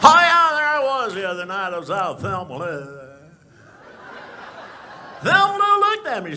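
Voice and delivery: brag, pitch jumping up; Barney Fife voice. Retold or imitated. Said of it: "Barney Fife".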